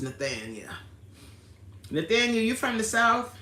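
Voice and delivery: ordinary talk, nothing marked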